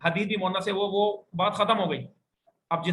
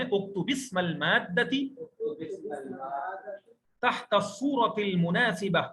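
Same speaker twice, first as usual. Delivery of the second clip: slow model reading; slower than usual. Shown as a demonstration. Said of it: "दोबारा बोलें".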